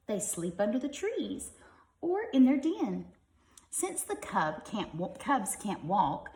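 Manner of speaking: lively, bright storybook reading; reading to children. Acted. Parent reading a tiger book to kids, lively storybook voice. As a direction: read it like a bedtime picture book.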